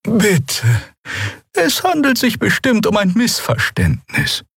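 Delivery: sehr hohe, fremde Stimme, bittend; hoch und flehend vermitteln; Xea, beschwichtigend. A narrator's reading. (Xea bittet um Frieden, sehr hohe Stimme)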